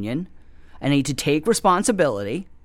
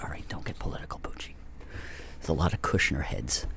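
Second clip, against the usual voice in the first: hushed self-scolding whisper; whispered aside; 11 semitones lower, 11 dB quieter, faster than usual. Real, not acted. Muttering to himself not to get political. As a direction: drop to a whisper to warn yourself.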